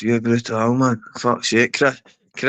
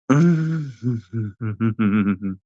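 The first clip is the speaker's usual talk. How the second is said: chuckle; 2 semitones lower than usual. Real, not acted. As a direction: chuckle away to yourself. Laughs amid mocking banter.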